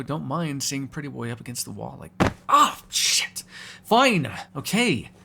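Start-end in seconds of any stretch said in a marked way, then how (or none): 2.5-5.1 sudden high cry, then loud grudging surrender; yelp, then give in with drawn-out annoyance; high, exasperated outburst; pained yelp. Acted. Hurt mid-sentence, gives in.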